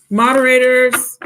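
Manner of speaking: calling out. Real, not acted.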